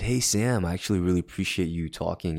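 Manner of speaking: normal talking voice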